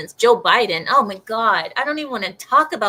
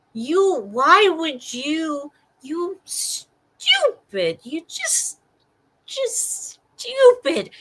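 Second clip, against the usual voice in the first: slow, high, disbelieving drawl; exasperated insult; 6 semitones higher, much slower than usual. Real, not acted. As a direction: drag out stupid in disgust. Frustrated insult at mayor.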